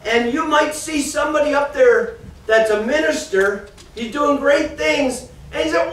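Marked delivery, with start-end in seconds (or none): none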